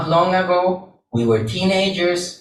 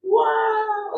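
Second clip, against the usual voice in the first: a much higher, long-held "Wow!"; awed wow. Acted. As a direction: marvel at how huge it all is. Awe at the size of the universe.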